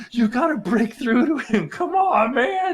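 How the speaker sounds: high, room-filling mock shout; extremely loud. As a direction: holler an exasperated coaching plea, hammed up. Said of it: "I am extremely loud".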